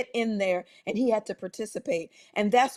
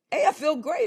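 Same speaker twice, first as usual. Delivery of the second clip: higher-pitched, cheerful report; imitating upbeat voice; 6 semitones higher, 3 dB louder than usual. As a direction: act out someone suddenly feeling great. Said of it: "you didn't sound like this".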